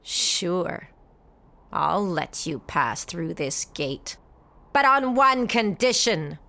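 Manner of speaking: a big brute bargaining in a low voice; sly giant gatekeeper. Acted.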